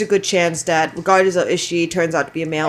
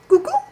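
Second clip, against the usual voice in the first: high cuckoo call. Real, not acted. Calling to the bird.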